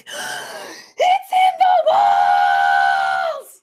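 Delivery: very high, loud, slow screech. Acted. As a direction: screech in panic. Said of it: "screeched Owl".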